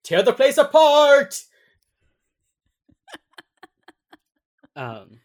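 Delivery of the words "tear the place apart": put-on high, loud, slow old man yell; hammed-up grandpa yell. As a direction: bellow the order like a grandpa. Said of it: "And then he goes".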